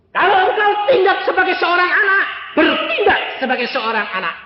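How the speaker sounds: high, loud exhortation; full-force preaching. Real, not acted. Preacher charging children to act, impassioned shout. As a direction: thunder it out, easing slightly at the end.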